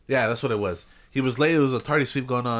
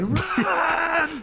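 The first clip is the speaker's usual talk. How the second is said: mock cry. Kids fleeing the teacher.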